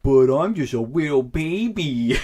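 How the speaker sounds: put-on slow, high, babyish whine. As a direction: talk like a whiny little baby. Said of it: "that's my baby voice".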